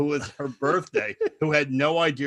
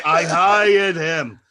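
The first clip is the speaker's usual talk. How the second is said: slow, loud, drawn-out protest; defensive shout; 3 semitones higher, 9 dB louder, much slower than usual. Retold or imitated. Woman who booked the entertainer to guests, defensive shout. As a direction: imitate a hostess insisting over the noise. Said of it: "The woman's going".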